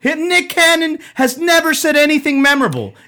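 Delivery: loud and high, all worked up; excited outburst. Real, not acted. Co-host gets fired up ranting that [name] has no memorable bars. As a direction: rant at full volume like he can't believe it.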